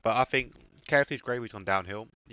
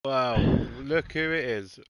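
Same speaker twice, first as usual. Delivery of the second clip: sarcastic; 3 semitones higher, 4 dB louder, slower than usual. Real, not acted. Teasing a friend who arrived.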